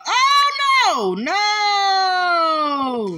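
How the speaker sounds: shrieking no in panic; real fright, yelling out loud; scared scream. Real, not acted. Jump scare in horror game.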